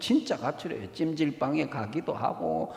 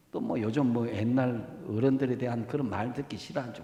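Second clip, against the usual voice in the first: usual speaking voice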